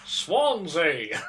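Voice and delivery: put-on hammy old English "Swansea!"; old-timey English accent. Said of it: "puts on the old-timey English accent".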